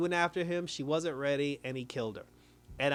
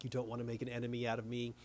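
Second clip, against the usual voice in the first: much softer, fast retold threat; quiet threat; 4 semitones lower, 9 dB quieter, faster than usual. The boss warns Leary off, hushed and fast. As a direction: rattle it off hushed, like a cool warning.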